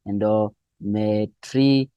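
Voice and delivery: slow, separated syllables in "endometrium". Shown as a demonstration. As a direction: sound out "endometrium" slowly for students. Teacher breaks down a new term.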